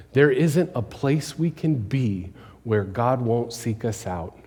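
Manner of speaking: ordinary talk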